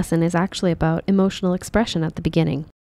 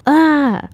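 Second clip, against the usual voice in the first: fearful cry; 8 semitones higher, 6 dB louder than usual. Shown as a demonstration. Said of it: "No one is always fearful".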